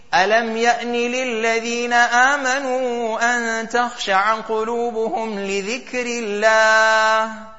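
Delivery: quick chanted Quranic verse; fast Quran recitation. Acted.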